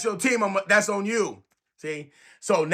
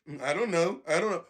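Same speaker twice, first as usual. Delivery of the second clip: soft, low, clueless mumble; 5 semitones lower, 6 dB quieter than usual. Retold or imitated. Clueless fan mumbling when asked a question, soft and low. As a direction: shrug it off with a blank, quiet mumble. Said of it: "stupid ass look on their face".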